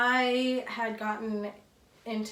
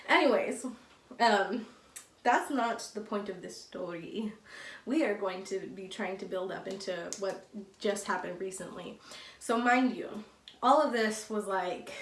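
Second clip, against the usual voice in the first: normal talking voice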